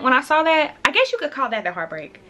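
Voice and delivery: ordinary talk, nothing marked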